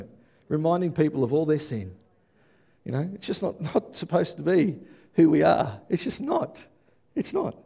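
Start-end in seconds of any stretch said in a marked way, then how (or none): 5.9-7.6 much higher, pressing on not; emphatic insistence. Real, not acted. Pastor rejecting a sin-focused church, emphatic high insistence. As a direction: insist it flatly isn't so.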